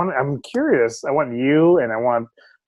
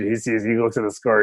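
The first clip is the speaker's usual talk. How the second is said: ordinary talk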